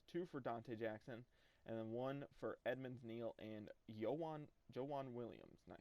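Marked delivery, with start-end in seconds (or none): 3.9-4.5 "Yowan" with an initial Y sound; alternative pronunciation. Real, not acted. Gamer reading stats, unsure of the name, "Yowan" starting with Y. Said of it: "Jowan, whatever". 4.7-5.1 "Jowan" with an initial J sound; alternative pronunciation. Real, not acted. Gamer correcting himself on a name, "Jowan" starting with J. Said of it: "Jowan, whatever".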